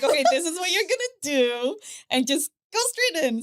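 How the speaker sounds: teaching her family how to give the shot, firmly; bossy instructor. Retold or imitated. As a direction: tell the family exactly how to inject. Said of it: "I had to teach them".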